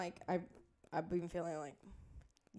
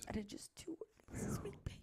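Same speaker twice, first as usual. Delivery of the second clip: whispered; 5 semitones higher, 10 dB quieter than usual. Real, not acted. Confessing under breath.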